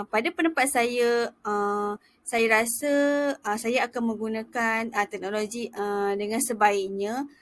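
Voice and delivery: non-fluent demo. Shown as a demonstration. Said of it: "bercakap tu lancar. Bukannya ada".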